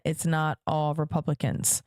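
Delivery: ordinary delivery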